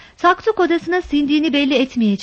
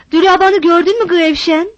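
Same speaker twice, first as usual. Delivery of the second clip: anxious call; 5 semitones higher, 5 dB louder than usual. A narrator's reading. Mother asks anxiously.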